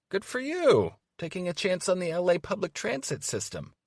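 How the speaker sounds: mock cheer. Mock congratulations.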